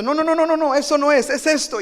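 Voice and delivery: loud, high insistent stop; emphatic no. Acted. Preacher acting out cutting off a prophet, emphatic. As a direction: cut them off firmly, loud and high.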